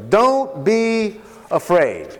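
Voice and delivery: slow and deliberate, each word weighed; slow it right down to reassure; slow, reassuring repeat. Shown as a demonstration. Joseph's words repeated for emphasis.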